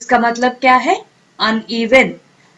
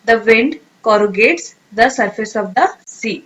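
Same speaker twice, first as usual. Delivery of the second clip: the wind corrugates the surface of the sea read very slowly and clearly; careful teacher read of the example sentence; slow model sentence; 3 semitones lower, much slower than usual. Shown as a demonstration. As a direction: read it out word by word for learners. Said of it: "sentence में कैसे use करेंगे".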